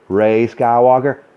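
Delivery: ordinary talk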